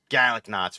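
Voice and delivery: garlic knots as kalik knots, no r; a hint of a Boston accent on garlic knots. Shown as a demonstration. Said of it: "We don't say it like that".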